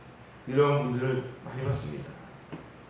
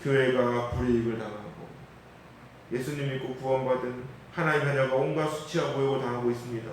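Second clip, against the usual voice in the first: normal talking voice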